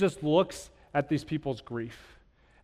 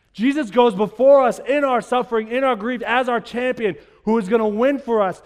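rising, louder sermon build; preaching; 5 semitones higher, 5 dB louder than usual. Acted. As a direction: preach it with fire and conviction. Preacher building to full force.

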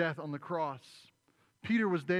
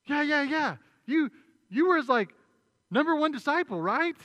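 much higher and louder, needling; insistent accuser; 11 semitones higher, 12 dB louder than usual. Retold or imitated. Second bystander needling Peter, high and loud. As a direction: poke at him with it.

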